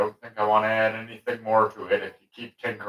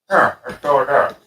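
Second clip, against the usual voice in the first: talking around a paintbrush; mouth full; 4 semitones higher, 5 dB louder than usual. Real, not acted. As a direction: paintbrush clenched in teeth. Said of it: "I had a paintbrush in my mouth".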